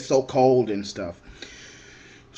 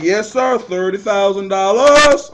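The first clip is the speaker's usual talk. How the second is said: louder, higher calm officer confirming; officer's reply; 4 semitones higher, 8 dB louder than usual. Retold or imitated. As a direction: confirm it matter-of-factly as the guard. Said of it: "He was like".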